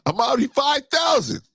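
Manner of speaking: high, shouted getaway cry; hammed-up getaway shout; excited Lot. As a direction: Lot bolting off, whooping. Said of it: "he just said".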